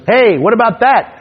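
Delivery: high heckler yelling out from the crowd; mock heckler shout; heckler call-out. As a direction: pitch it way up, a cocky heckler butting in. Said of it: "some guy goes".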